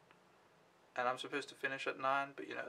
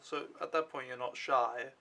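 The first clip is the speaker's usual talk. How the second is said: ordinary talk, nothing marked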